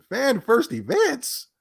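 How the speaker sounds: Fan-first events? slow, pitched high in disbelief; incredulous mocking echo. Shown as a demonstration. Vlogger repeats the host's tweet phrase, mocking disbelief. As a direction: echo the phrase back in scorn.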